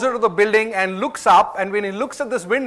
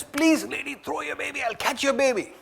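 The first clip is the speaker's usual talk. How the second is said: higher, softer, urgent plea; pleading; 5 semitones higher, 9 dB quieter than usual. Retold or imitated. Man pleads with the mother on the third floor, soft high plea. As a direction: beg her quietly to let go of the baby. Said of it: "He says".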